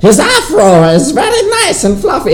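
high, put-on cartoon mayor voice. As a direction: do the Powerpuff mayor, high and silly. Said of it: "had me start talking about".

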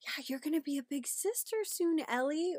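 high-pitched mommy voice; talking to a toddler. Acted. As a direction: tell a little one big news in a lifted voice. Mother voiced talking to her toddler.

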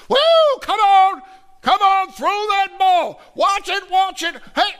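high, full-throated yell at the TV; wild, loud game-day hollering; screaming sports fan. Acted. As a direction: scream at the players like they can hear you. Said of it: "we're screaming and yelling".

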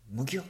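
high, loud kicked grunt; pained monster grunt. Acted. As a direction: yelp as the kick lands.

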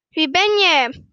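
loud answering shout; echoed call. A narrator's reading. Mountain voice answers the boy, echoed loud call. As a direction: call it back just as it came. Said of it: "hij krijgt als antwoord".

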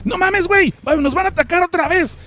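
high-pitched mock Mexican dub; mock dubbed panic. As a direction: redo the line as a Mexican dub. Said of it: "se ha traducido".

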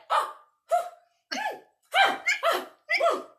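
very high, loud strung-out oh oh; mock outraged cries. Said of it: "I was like".

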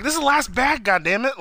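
high-pitched retold complaint; mock exasperation. Imitating her out of snacks from waiting, exasperated.

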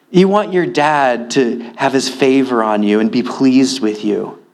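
usual speaking voice

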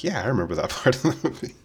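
high, laughing mock reply; laughing fake agreement. Answering a friend about the movie while laughing. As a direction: play along through a laugh. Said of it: "I'm like".

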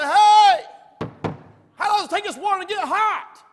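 high, very loud indignant yell; calling out. Retold or imitated. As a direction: holler from the shower for someone to hear. Calls out from shower stall.

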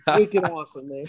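playful Boston-accented slang; light, joking Boston accent. Shown as a demonstration. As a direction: toss it off like a Bostonian. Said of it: "you can do your Boston".

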